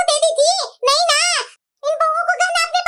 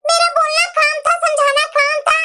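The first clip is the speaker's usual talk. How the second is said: usual speaking voice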